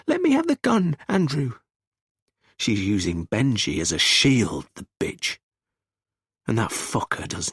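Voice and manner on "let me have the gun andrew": high plea, falling on the name; whimpering. A narrator's reading. Wife coming towards her armed husband, whimpering plea. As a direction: beg in a frightened, high voice. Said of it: "Essie's whimpering now".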